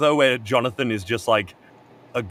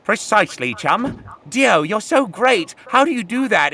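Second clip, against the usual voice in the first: mock British accent; 8 semitones higher, 3 dB louder than usual. Boys praising Dio, higher mock British accent. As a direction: fawn over Dio in a put-on British accent. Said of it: "the native British accent".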